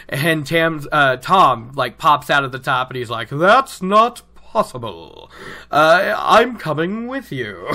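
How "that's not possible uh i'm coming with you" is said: theatrical interjection. Retold or imitated. Thom pops up and interrupts.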